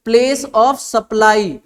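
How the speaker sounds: "place of supply" drawn out very slowly; slow key term. Shown as a demonstration. Teacher naming the second chapter to the class, very slow. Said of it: "और एक chapter है".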